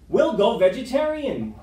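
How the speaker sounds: wolf proudly proposing to go vegetarian; eager idea. Acted. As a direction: pitch it as a bright new plan. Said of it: "the timber wolf came up with an idea".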